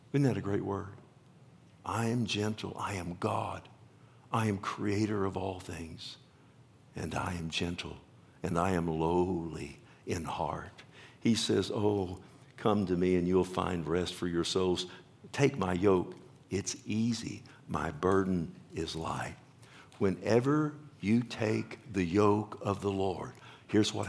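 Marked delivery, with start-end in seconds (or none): 1.9-10.8 soft, lowered voice of a gentle Lord; gentle, lowly. Acted. Preacher voices Jesus describing himself as lowly, gently and softly. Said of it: "where does this gentleness come in". 11.7-19.4 softened, lower, tender voice; gentle invitation. Acted. Preacher voices Jesus offering rest to souls, gently. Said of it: "where does this gentleness come in".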